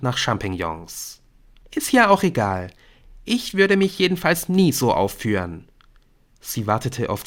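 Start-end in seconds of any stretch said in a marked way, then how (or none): 1.7-5.7 put-on high girl's voice, dismissive; self-righteous schoolgirl. A narrator's reading. Brynn seeks Nick's agreement, high and self-righteous.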